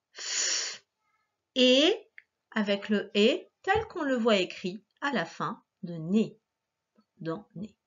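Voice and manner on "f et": "F" as a long hissed fff, then "et"; sounding out. Shown as a demonstration. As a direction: hold "F" on breath alone, pause, say "et". Teacher sounds out a syllable.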